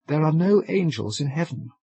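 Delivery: put-on girl corrects the sailor with an earnest cry; cried out. A narrator's reading. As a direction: cry it out against his joke. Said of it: "cried the girl".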